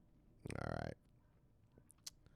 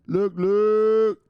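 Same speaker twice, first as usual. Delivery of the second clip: loud, high "look, look"; pumped-up shout; hyped catchphrase; 10 semitones higher, 8 dB louder than usual. Real, not acted. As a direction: shout it out like a hype man.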